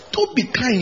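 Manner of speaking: ordinary talk, nothing marked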